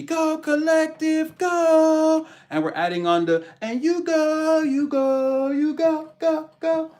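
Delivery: high excited cheering; hype cheer. Real, not acted. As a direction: cheer them on, high and loud. Hyping up the audience.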